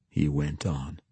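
usual speaking voice

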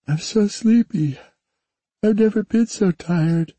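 put-on high, drowsy child voice; a tired young girl just waking; sleepy little girl. A narrator's reading. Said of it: "The young girl yawned and stretched".

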